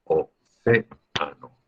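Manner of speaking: Océano said very slowly, each syllable pulled apart; slow teacher's model of Océano, separating the vowels; syllabified slowly. Shown as a demonstration. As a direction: spell out Océano by ear for the class. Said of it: "tienen que ir separados".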